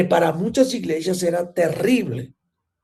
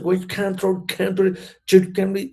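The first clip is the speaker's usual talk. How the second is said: a hint of a boastful star preacher in English; mocking star preacher; 3 semitones higher than usual. Preacher mocking star preachers, lightly boastful English. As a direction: lightly mimic a proud preacher's slogan. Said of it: "predicadores estrellas".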